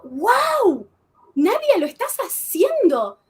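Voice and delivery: a high, bright burst of wonder at herself; thrilled self-cheer. Retold or imitated. Woman recalls praising herself while rappelling, excited. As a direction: cheer yourself on mid-rappel, buzzing with adrenaline.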